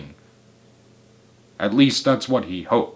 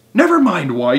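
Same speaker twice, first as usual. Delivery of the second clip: high, loud impatient shout; 7 semitones higher, 7 dB louder than usual. A narrator's reading. Mugglewump silences his helpers' questions, shouting. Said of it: "shouted Mugglewump".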